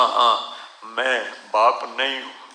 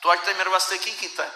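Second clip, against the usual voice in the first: quick, raised, contemptuous Punjabi jab; scornful son; 7 semitones higher, much faster than usual. Retold or imitated. Son throwing scorn at his old father, quick and high. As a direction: spit the question out fast and high. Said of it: "ایک بچہ اپنے باپ سے کہہ رہا تھا".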